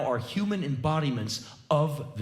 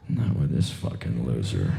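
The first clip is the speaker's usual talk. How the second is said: much lower, muttered aside under her breath; inner thought. Pretend. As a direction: drop it low, a hidden sneer.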